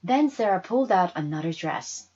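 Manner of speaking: ordinary delivery, nothing marked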